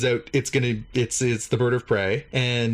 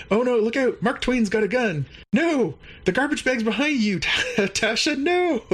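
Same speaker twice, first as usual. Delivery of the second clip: high-pitched mock-frantic warning; hammed-up panicked cries, breaking into a laugh; delirious panic; 11 semitones higher than usual. Delirious crewman warning his friends of imaginary danger, in high mock panic. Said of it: "Billups is acting really weird".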